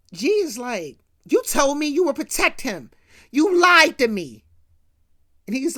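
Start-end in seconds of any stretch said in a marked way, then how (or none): 1.3-4.4 a touch of hurt anger, raised; hurt accusation. Retold or imitated. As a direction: throw the broken promise back at him. G accuses JC of lying.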